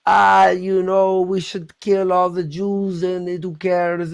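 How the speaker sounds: slow, lazy put-on voice; a hammed-up offhand hater; mock casual bigot. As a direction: drawl it out as if it's nothing. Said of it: "for when you're like".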